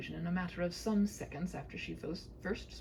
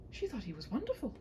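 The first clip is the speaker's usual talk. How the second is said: ordinary delivery, nothing marked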